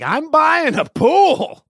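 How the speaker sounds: put-on ecstatic kid shout. Daughter announcing to dad she is buying a pool, thrilled shout. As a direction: shout it like an overjoyed eleven-year-old. Excited daughter voiced in story.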